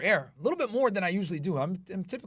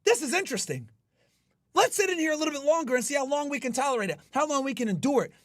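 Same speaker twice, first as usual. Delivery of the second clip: higher, upbeat challenge to himself; eager self-talk; 7 semitones higher than usual. Retold or imitated. Streamer voicing his inner gym talk, eager and higher. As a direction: relish the pain, say it keenly. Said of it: "I say".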